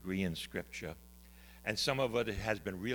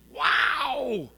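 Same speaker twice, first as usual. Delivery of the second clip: a high, loud, amazed "Wow!"; a Midwestern farmer's delighted cry; amazed exclamation; 14 semitones higher, 10 dB louder than usual. Retold or imitated. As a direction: let it burst out with country wonder. Jim amazed on the phone.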